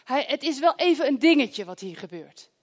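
ordinary delivery

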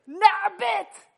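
high, loud command to go to bed; a mother shouting a bedtime order; yelling bedtime. Retold or imitated. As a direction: bellow it up the stairs. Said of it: "ik schreeuw nog een keer en nog een keer".

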